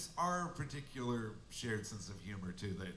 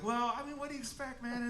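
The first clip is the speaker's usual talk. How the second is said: high mock innocence; 9 semitones higher, faster than usual. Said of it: "I was like".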